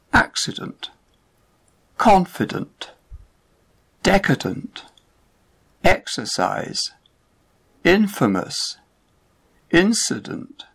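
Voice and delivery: each word alone, first syllable punched: ACcident, CONfident, DEcadent; prefix stressed. Shown as a demonstration. Teacher reading a stress list to learners, prefix stressed. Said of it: "Prefixes are usually stressed".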